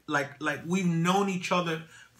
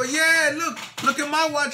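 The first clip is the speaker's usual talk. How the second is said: higher, louder, eager to show off; excited; 12 semitones higher, 7 dB louder than usual. Retold or imitated. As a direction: proudly shout to the owner. Showing off his own watch.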